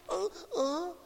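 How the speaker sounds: high, sobbing gasps between words; badly faked weeping, overcome with feeling; mock crying. Preacher imitates weepers crying at the drop of a hat, mock sobbing. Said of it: "they can cry at the drop of a hat".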